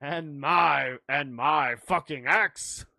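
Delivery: slow, emphatic film-quote voice; mock heroic pledge. Joker pledging an axe from Lord of the Rings, mock-heroic. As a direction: pledge it slowly with mock grandeur.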